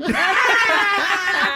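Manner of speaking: high, loud burst of laughter. Real, not acted. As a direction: crack up loudly at the prank. Prankster cracks up at reveal.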